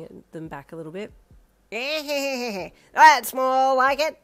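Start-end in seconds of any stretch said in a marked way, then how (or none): 1.7-2.7 gleeful laugh. A narrator's reading. Creature laughs at the fight. 2.9-4.2 loud, slow, gloating shout; a wild fighter thrilled to finally have a fight. A narrator's reading. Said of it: "the thing shouted".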